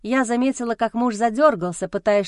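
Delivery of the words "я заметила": ordinary delivery, nothing marked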